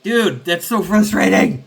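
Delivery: loud, exasperated cry at the dice; frustrated outburst. Real, not acted. Player groaning at his bad luck, loud exasperated.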